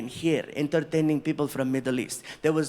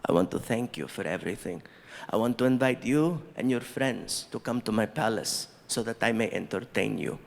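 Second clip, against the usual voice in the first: polite prince; 8 semitones lower than usual. Retold or imitated. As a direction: play the gracious prince inviting them. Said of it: "he says".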